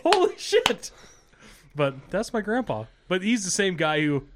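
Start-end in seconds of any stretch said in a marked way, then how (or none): 0.0-0.9 squeaky high mock shock, louder; a high, shocked yelp; mock shocked squeal. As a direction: squeal it in disbelief. Retold own reaction, high.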